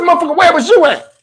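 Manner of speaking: high, mock outraged retort; indignant comeback. Answering friend Steve who asked where he was: indignant mock anger. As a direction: fire the question back at him, offended. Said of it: "I said".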